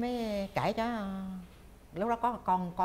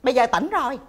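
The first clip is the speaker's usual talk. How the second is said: lively, louder and higher; clear-headed now; 5 semitones higher, 11 dB louder, faster than usual. Real, not acted. Woman explaining she can joke about it now, lively. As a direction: speak up briskly, having come to your senses. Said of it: "nói trong cái tâm thế này".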